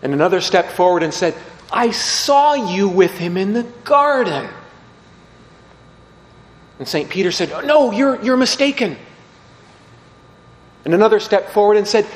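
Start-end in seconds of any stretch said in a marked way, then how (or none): 1.7-4.5 louder, higher, slow accusing voice; accusing bystander. Retold or imitated. Bystander accusing Peter by the fire, loud and slow. 7.6-9.0 high, loud, flustered denial; panicked denial. Retold or imitated. Peter denying Jesus to the crowd, high and panicked.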